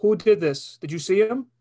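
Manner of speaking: high, loud urgent questioning; tense cop. Acted. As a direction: press the witness hard. Cop questions kid in skit.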